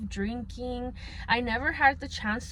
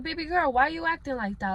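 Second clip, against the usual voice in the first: higher-pitched chiding retelling; mildly chide, pitched up; retold scolding; 6 semitones higher, faster than usual. Older sibling retelling how she confronted her sister, mildly scolding.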